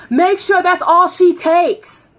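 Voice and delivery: high, loud, slow holler; supervisor yelling orders. Retold or imitated. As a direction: shout each word across the office.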